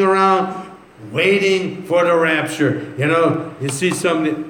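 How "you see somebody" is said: ordinary talk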